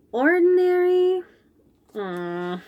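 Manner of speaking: ordinary delivery, nothing marked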